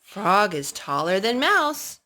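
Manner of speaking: ordinary delivery, nothing marked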